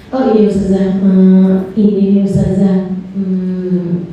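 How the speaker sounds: low, unhurried hint of a dreamy talker; drifting from topic to topic in a low voice; rambling congregant. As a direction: meander without getting to the point.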